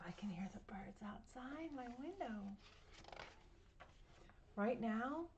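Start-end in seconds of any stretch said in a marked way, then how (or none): 0.1-2.3 soft hushed whisper. Real, not acted. Pointing out birds outside her window to the child, whispered. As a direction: whisper as if not to scare the birds.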